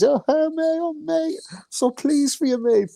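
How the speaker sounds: high, sugary put-on cheer; mock gushing Eddie. Eddie fawning over Ryder, in a high, sugary mock voice. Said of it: "the tone of his voice change".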